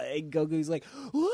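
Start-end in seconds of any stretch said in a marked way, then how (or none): 1.1-1.3 hammed-up wail of being hit; mock cartoon scream. Goku reacting to Hercule's fakest punch ever, mock scream. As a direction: fake yelp as if knocked flying.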